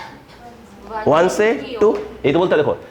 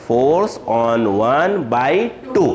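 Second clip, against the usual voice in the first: very slow, word by word; lower, drawn-out teacher's dictation; 4 semitones lower, much slower than usual. Shown as a demonstration. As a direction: stretch out each word as if dictating. Teacher dictating how to word notation.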